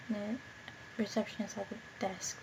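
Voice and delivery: whispered under breath; whispering. Real, not acted. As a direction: whisper it softly.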